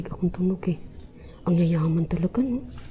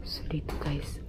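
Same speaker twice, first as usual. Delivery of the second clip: soft whisper; 3 semitones lower, 7 dB quieter than usual. Real, not acted. Sneaking forward.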